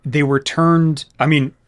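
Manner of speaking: loud, rising to high disbelief on Sweetwater; can't believe they missed it; loud incredulous question. Real, not acted. Incredulous GM clarifying.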